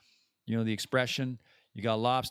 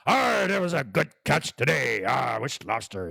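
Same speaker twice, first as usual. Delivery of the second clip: radio announcer voice. Acted. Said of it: "doesn't talk like a radio announcer".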